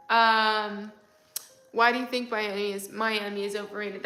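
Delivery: ordinary delivery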